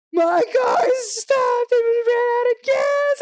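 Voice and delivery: high, loud, slow panicked yell; retold scream. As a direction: yell it in a panic to mom on the phone. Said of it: "And I'm, like, screaming".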